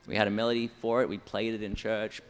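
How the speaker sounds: normal talking voice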